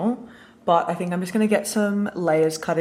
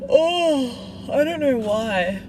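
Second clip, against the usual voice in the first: anxious sigh; 8 semitones higher, 5 dB louder, much slower than usual. Real, not acted. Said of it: "I feel really anxious today".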